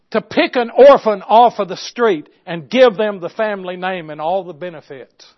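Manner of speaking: forceful preaching with weighty emphasis; big, emphatic pulpit delivery. Real, not acted. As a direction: proclaim it loud to the congregation.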